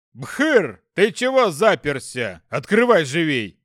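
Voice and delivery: loud demon yelling through a door; demon shouting. Acted. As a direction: bark at a comrade to open up.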